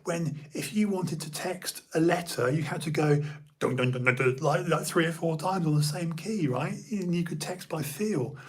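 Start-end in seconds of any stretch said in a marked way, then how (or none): 3.6-4.1 quick, low run of "dun" beeps; imitated key presses. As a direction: tap out the keys with your voice. Imitating repeated phone key presses.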